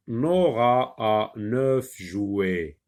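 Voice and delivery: Nora a neuf jouets read very slowly, Nora drawn out; slow model reading. Shown as a demonstration. Teacher models the sentence slowly to be repeated. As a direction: spell it out slowly for the child to repeat. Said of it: "Répète".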